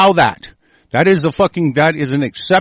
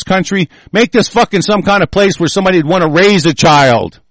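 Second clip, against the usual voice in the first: loud, higher, furious rant; swearing tirade; 4 semitones higher, 3 dB louder than usual. Real, not acted. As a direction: hammer home the blame. Escalating angry rant at listeners.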